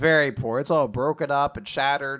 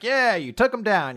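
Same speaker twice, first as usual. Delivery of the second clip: high, mildly elated cheer; upbeat acted congratulations; celebrating crowd; 7 semitones higher than usual. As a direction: cheer them on like a grateful crowd. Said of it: "everyone starts celebrating".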